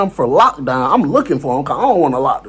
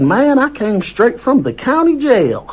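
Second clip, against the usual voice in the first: put-on Southern country accent, pitched higher; imitated country accent; 8 semitones higher than usual. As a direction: keep the country kid's voice going. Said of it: "The accent, bro, that was good".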